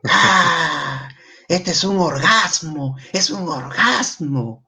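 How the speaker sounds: high, drawn-out cry of delight; ecstatic shout. Retold or imitated. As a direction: shout it like you've just finished the best book ever.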